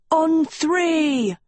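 high, drawn-out command; contained roar with pitch thrown up. A narrator's reading. Tedros rallying attackers outside the doors, roaring. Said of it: "Tedros roared outside".

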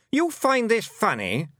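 high, slow, indignant queen; demanding. A narrator's reading. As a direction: demand it with offended disbelief. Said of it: "she demanded".

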